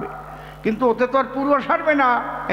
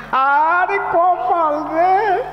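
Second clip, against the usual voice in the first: stretched-out high wail of "আরে কপাল রে", much slower; woeful lament; 8 semitones higher, 4 dB louder, much slower than usual. Acted. Performer laments to the crowd in a drawn-out high wail. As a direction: moan at one's fate, dragging it out. Narrator laments the absurd advice.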